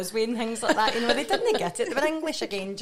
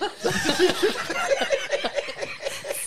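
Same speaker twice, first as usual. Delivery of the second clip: big laugh; 10 semitones higher, 4 dB louder than usual. Real, not acted. Host cracks up at milk joke.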